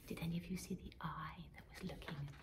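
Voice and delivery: fast secretive whisper of a hidden watcher; hushed whisper. Real, not acted. Narrator whispers to children about the watching eye. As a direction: whisper as if sharing a spooky secret. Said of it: "narrator whispers about the eye".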